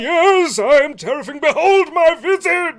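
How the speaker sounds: high, loud ghost voice, laughing; unearthly voice. Acted. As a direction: ham up the spooky ghost. Ghost boasting to players.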